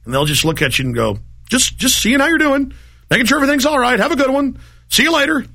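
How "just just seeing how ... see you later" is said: high, sing-song fake-friendly voice, much higher; mock innocent fed. Agents caught out brush him off, mock innocent high voice. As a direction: play dumb in a sugary high voice.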